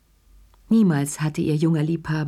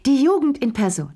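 high shrieked "Jugend", loud and shrill in pitch; shrieking; 8 semitones higher, 3 dB louder than usual. A narrator's reading. Madame Pelou shrieking with mocking laughter. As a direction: screech "die Jugend in Person" up high. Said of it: "kreischte Madame Pelou".

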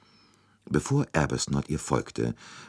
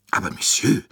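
protesting cry, higher and louder; protestierend; 6 semitones higher, 5 dB louder than usual. A narrator's reading. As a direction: call out in protest. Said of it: "rief Monsieur Bouc protestierend". (protestierender Ausruf)

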